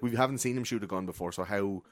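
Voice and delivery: usual speaking voice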